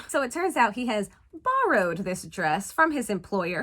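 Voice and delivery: usual speaking voice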